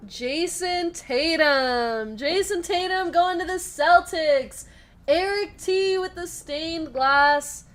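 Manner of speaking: loud, drawn-out announcement of a big hit; excited reveal. Real, not acted. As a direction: announce the big pull, stretching the name out.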